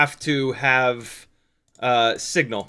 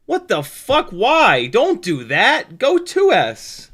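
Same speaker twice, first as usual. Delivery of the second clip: much higher, loud, slow exasperated scolding; mock outrage; 6 semitones higher, 4 dB louder, much slower than usual. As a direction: scold him like it's absurd. Mock outrage at bad advice.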